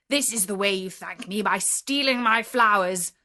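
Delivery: menacing beast, rage rising to a loud roar; terrible beast voice. Acted. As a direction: bellow at the thief who picked your roses. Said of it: "said in a terrible voice".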